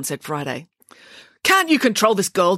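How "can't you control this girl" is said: raised, higher-pitched demand; loud, indignant question; exasperated demand. A narrator's reading. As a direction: demand it of the person in charge. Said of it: "demanded Mister Wainscott".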